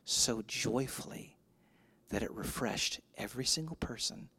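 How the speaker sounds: low, soft, measured delivery with pauses; hushed preaching. Real, not acted. Pastor honoring a man who labored, hushed reverent preaching. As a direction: let it sink almost to a whisper by the end.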